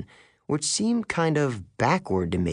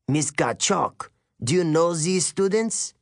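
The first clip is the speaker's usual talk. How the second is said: put-on French-accented teacher, questioning; 5 semitones higher than usual. A narrator's reading. Male teacher asks his colleague, in a French accent. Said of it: "He had an accent. French, maybe".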